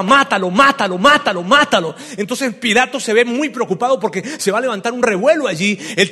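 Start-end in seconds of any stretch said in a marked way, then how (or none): 0.0-1.9 louder, chanted crowd demand; mock crowd yell. Crowd yelling at Pilate for execution, loud chant. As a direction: chant it like an angry mob. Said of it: "Y el pueblo gritaba".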